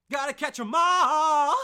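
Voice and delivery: slow, high shout of the catchphrase; Pokemon slogan shout. Pretend. Vlogger blurting the Pokemon slogan, a slow high shout. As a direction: belt it like the cartoon theme.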